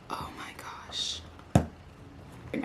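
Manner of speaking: slow breathless whisper; hushed whisper of wonder; awed whisper. Real, not acted. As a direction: whisper in reverence. Lifting the phone out.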